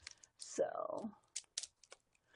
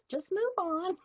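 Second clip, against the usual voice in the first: soft teasing repeat; 8 semitones higher, 3 dB quieter, slower than usual. Acted. Repeats brush-off, softer.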